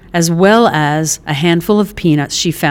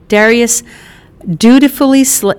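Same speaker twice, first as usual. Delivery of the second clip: ordinary delivery, nothing marked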